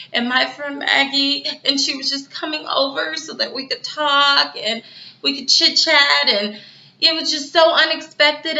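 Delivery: high, breaking mock sob, fading to a near-voiceless squeak; fake tearful act. Veronica lying to the detective about her friend Maggie, fake tearful. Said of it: "it was like an act she was putting on".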